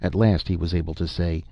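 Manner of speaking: plain reading voice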